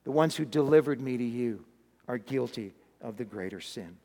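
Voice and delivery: low, hushed pronouncement; calm judgement. Acted. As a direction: deliver the verdict softly. Jesus calmly passes judgement.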